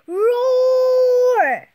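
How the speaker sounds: loud high roaring dinosaur. Acted. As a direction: roar like a big dinosaur. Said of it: "said the dinosaur".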